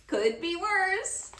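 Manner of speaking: high, slow teasing reply; lightly voiced grandpa; drawn-out grandpa punchline. A narrator's reading. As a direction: savor the punchline.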